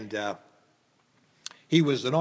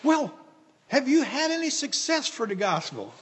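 mock eager young man; 12 semitones higher than usual. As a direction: play the keen young fellow asking. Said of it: "like a young man would".

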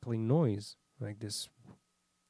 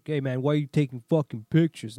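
rude demand; 3 semitones higher, 8 dB louder than usual. Shown as a demonstration. To the towing guy, the rude version he didn't use: hostile. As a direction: snap it at him like a picked fight. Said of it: "I didn't, like".

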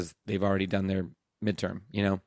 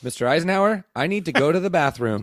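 higher, louder innocent request; innocent student; 7 semitones higher, 5 dB louder, faster than usual. Retold or imitated. Student announcing to the teacher an overly innocent bathroom excuse. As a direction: sell the fake bathroom excuse.

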